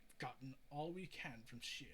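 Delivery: usual speaking voice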